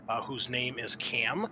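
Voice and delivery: ordinary delivery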